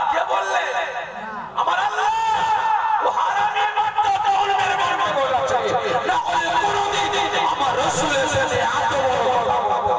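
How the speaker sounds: high, excited shouting; laughing shout. Real, not acted. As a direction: shout it gleefully. (হাসতে হাসতে চিৎকার)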